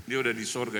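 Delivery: usual speaking voice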